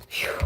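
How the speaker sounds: long steadying blown-out breath; heavy exhale. Real, not acted. Reader apologizing to viewers as she breathes it out, heavy exhale. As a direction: breathe out the overwhelming feeling. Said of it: "I'm trying to breathe this out".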